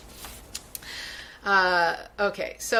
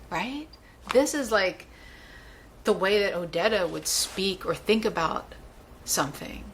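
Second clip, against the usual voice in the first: ordinary delivery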